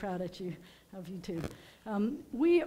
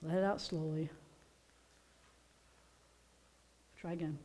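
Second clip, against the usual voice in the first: calm breathing guide; 5 semitones lower, 8 dB quieter, faster than usual. Shown as a demonstration. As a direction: guide the group to breathe out gently. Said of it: "we need a deep breath now".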